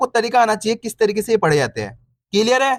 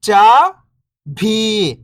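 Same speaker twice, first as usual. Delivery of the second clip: first syllable lifting, pause, second falling; slower than usual. Shown as a demonstration. Teacher breaking a word down for beginning readers, syllable by syllable. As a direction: sound out each syllable alone, long and separated. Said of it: "एक अक्षर का उच्चारण करके".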